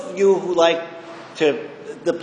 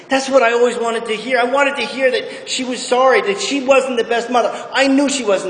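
tearful; 7 semitones higher than usual. Real, not acted. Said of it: "And I'm crying".

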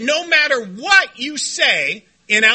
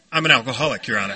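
prompt confession; 12 semitones lower, much faster than usual. Retold or imitated. Plays defendant answering judge.

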